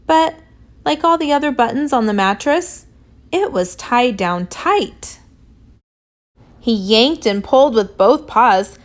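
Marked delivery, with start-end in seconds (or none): none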